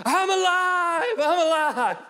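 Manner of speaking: very high, slow, drawn-out whoop; ecstatic cry. Retold or imitated. Man jumping on the bed at night, ecstatic shouting. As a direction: shout it like you just came back to life. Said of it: "I'm on the bed going like this".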